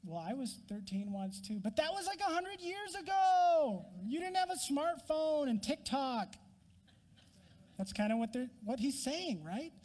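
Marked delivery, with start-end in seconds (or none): 1.6-6.4 put-on sulky teenager. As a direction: roll your eyes at mom. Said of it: "the teenager".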